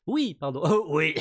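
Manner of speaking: hoarse, loud little robot voice; raspy Pino voice. Acted. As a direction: scrape it out like a rusty little robot. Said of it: "j'ai fait une voix un peu rauque à, à, à Pino".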